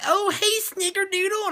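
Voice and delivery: very high, cutesy lovey-dovey voice; mock-gushing pet-name greeting; sappy sweetheart. Girlfriend greeting her boyfriend, mock sappy.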